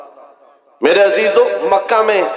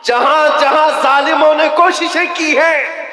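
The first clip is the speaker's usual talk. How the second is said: rally speech; 11 semitones higher than usual. Acted. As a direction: rouse the crowd at full force. Fiery preaching climax.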